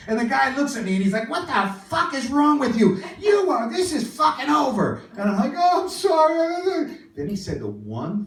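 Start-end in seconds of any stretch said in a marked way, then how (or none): 1.3-5.0 high, exasperated mock rant; angry outburst. Man angrily ends the encounter. 5.6-7.0 high, loud, drawn-out wail; mock tearful apology. Tearful apology after bawling.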